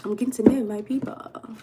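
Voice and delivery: whispered aside to herself. Real, not acted. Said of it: "whispered repeat".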